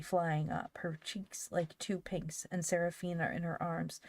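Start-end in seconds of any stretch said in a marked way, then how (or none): none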